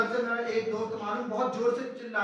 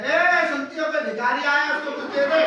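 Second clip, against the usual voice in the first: higher and louder, calling to the wife inside; father calling out; 6 semitones higher, 8 dB louder than usual. Retold or imitated. Father calling to his wife about a beggar, loud call. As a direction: shoo off a beggar through the house.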